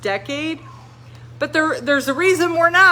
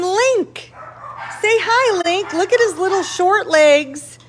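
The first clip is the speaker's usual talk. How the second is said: talking to a dog; 7 semitones higher than usual. Real, not acted. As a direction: coo at the little dog.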